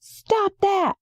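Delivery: high, shouted outburst; cries out. Acted. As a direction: bark it out in shock. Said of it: "and then he cries out".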